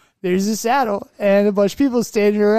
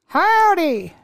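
high, hammed-up cowboy howdy; imitating his own howdy at the restaurant; mock cowboy howdy; 9 semitones higher than usual. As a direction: holler howdy like a rodeo cowboy. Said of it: "I went".

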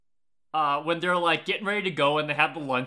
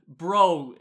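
ordinary talk, nothing marked

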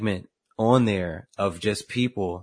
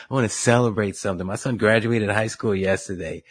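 ordinary talk, nothing marked

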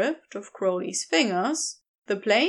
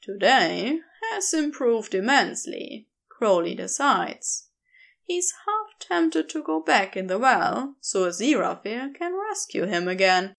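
plain reading voice

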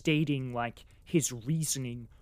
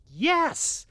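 a much higher, louder yes; eager yes; 12 semitones higher, 5 dB louder than usual. Retold or imitated. Reader cheering for war, high excited yes. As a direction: squeal it in excitement. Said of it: "I was just like".

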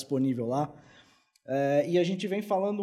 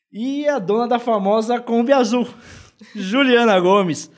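loud announcer; 6 semitones higher, 7 dB louder than usual. Acted. Announcing guest like a ringmaster.

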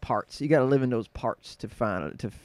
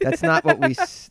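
high, louder mock complaint; offended Pittsburgh locals; 6 dB louder than usual. Guest imagining Pittsburgh listeners objecting, mock indignant. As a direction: protest as an offended local, pitched up. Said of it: "They're like".

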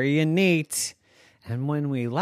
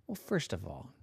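unvoiced, lower, quick; skeptical whisper; 8 semitones lower, 12 dB quieter, faster than usual. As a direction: whisper the doubt without waking anyone.

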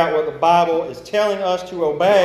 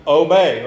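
"obey" hit louder, falling; stress on obey; 5 dB louder than usual. Shown as a demonstration. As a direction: lean hard on "obey". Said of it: "not a suggestion".

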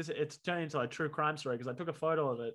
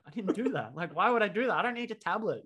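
indignant denial; 5 semitones higher, 5 dB louder than usual. Retold or imitated. Retold denial of stealing.

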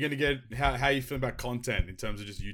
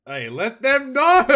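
high, slow hype shout; excited shout; 14 semitones higher, 14 dB louder, slower than usual. Real, not acted. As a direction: cheer him on loudly. Hyping up friend's saying.

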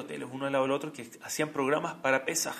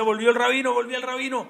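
excited cry; 9 semitones higher, 8 dB louder than usual. Retold or imitated. Staff celebra al rabino.

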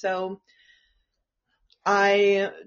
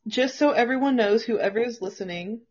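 ordinary delivery

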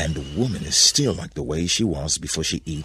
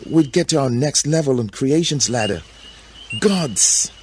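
high, rising ambition; exalted Eve; 8 semitones higher, 3 dB louder than usual. A narrator's reading. Eve justifying herself to Adam, high exalted excitement. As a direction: build higher toward the last word.

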